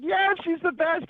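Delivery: high, slow, sneering mock cheer; mocking sarcasm. Mocking CNN's turnaround on Harris, slow high sarcastic gush. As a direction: ham up the media's gushing praise.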